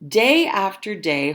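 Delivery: usual speaking voice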